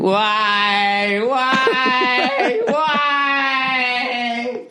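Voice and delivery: high, rising wail of why; hammed-up sobbing Nancy Kerrigan; mock wailing cry. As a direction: howl it like a hurt skater.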